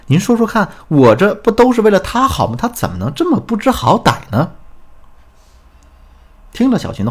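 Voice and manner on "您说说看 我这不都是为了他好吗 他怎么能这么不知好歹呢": high, indignant acted complaint; aggrieved protest. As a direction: appeal to the counsellor as the wronged wife. Acted wronged wife complaining to counsellor. (模仿学员委屈地抱怨)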